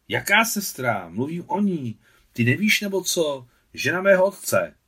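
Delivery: high, rising to loud impatience; exasperated. A narrator's reading. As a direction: set him straight crossly. Irritated boy keeps correcting Adam.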